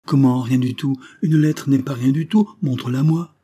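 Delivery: loud, high, indignant demand; jealous indignation. Acted. Jealous husband demanding his wife's letter, indignant.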